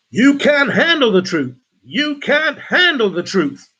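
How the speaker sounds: high, loud, strained movie outburst; big hammed-up actor's shout; film actor impression. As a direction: belt out a dramatic courtroom-style film line. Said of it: "That's my best impersonation of that actor".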